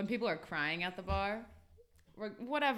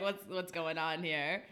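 ordinary delivery

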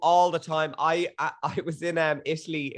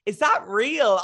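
retold wide-eyed disbelief; amazed question; 7 semitones higher, 5 dB louder, slower than usual. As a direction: imitate an astonished question, higher and louder. Retells amazed question to mum.

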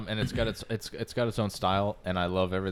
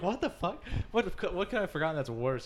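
much higher, shocked and incredulous; high-pitched disbelief; 7 semitones higher, 5 dB quieter than usual. Retold or imitated. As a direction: squeak out the disbelief. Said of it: "I'm like".

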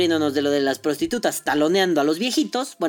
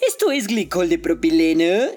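mock technical explanation; 3 dB louder than usual. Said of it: "no me voy a poner a explicarle".

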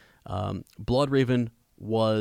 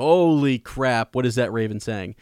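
astonished exclamation; 6 dB louder than usual. Retold or imitated. Reader reacting to the raven going off script, astonished. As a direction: blurt it out, stretching the first words.

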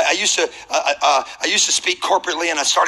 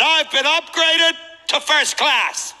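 much higher, slow, savoring every word; thrilled disbelief; 10 semitones higher, slower than usual. Retold or imitated. Upgraded passenger echoing the gate agent, thrilled and drawn out. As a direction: echo it back like a dream come true. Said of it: "I said".

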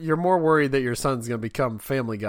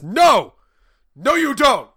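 loud, high comic "no"; mock outraged dad; 11 semitones higher, 8 dB louder, slower than usual. Father stopping son repeating the rude joke, mock angry shout. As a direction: cut the boy off with hammed-up outrage.

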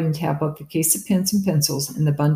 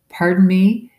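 higher, louder, rising in disbelief; incredulous; 4 semitones higher, 8 dB louder than usual. A narrator's reading. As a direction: echo her words in shock. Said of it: "Is that really what she said".

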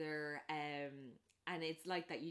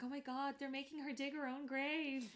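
higher horrified gasp; mock horror; 8 semitones higher than usual. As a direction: assume the worst. Friends horrified.

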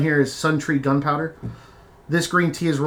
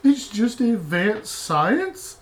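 much higher, slow disbelieving question; incredulous echo; 8 semitones higher, much slower than usual. Acted. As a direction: repeat it in total disbelief. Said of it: "echoing the wizard line".

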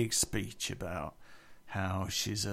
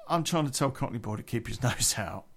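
usual speaking voice

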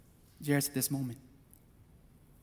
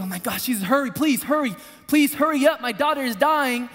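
rising, urgent plea to hurry; desperate pleading; 5 semitones higher, 3 dB louder than usual. Retold or imitated. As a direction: beg Jesus to hurry as your daughter dies. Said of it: "So he's probably thinking".

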